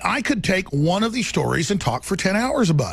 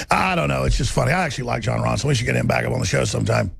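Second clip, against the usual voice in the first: ordinary talk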